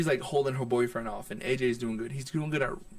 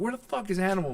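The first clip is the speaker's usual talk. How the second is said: high-pitched call at ordinary volume; retold calling out; 8 semitones higher than usual. Comic looking for DJ Animal to take over, calling out. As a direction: replay calling out for someone, pitched up. Repeats his call for the host.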